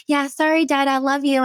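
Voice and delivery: put-on high-pitched, hurried-off toddler apology; playful little-kid voice; little daughter. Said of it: "And she's like".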